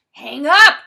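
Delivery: loud, high, short command; yelled order. A narrator's reading. Frosty yells at the boy to end the call, loud command. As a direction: shout it to cut off the call. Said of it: "Frosty yells again".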